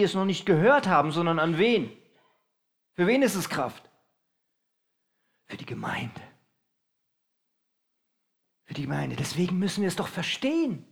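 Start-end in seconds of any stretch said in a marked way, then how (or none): 5.4-6.2 soft confiding whisper; preacher whispers the answer to his own question. Acted. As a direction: let the answer out as a secret.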